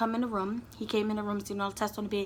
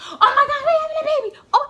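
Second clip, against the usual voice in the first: much higher and louder, laughing with joy; overjoyed dad; 11 dB louder, faster than usual. Retold or imitated. Imitating the boyfriend's ideal reaction to the news, ecstatic laughing squeal. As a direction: squeal it like the happiest new father. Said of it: "he should have been like".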